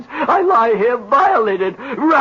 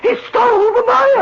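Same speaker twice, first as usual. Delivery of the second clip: higher, louder, theatrical outrage; outraged wail; 13 semitones higher, 3 dB louder than usual. Acted. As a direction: repeat it with wounded fury.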